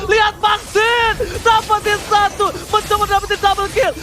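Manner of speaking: hyped live play-by-play call; fighting game announcer. Acted. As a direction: call the teamfight like a hype caster. (komentator esports yang bersemangat)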